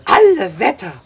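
high, loud, excited marvelling; amazed admiration. A narrator's reading. Sam praising the boy's silent capture, amazed. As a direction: exclaim with escalating wonder.

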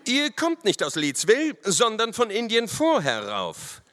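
high, forceful officer voice; accusing officer. A narrator's reading. Lieutenant contradicts the riders' story, stern.

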